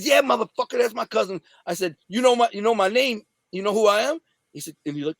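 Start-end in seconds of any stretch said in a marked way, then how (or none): 0.0-1.4 high, heated comeback; retold angry retort. Answering the guys who asked about his cousin, heated and confrontational. 2.1-4.2 high-pitched, confrontational questioning; retold tough challenge. Challenging the guys who hit his cousin, high and confrontational.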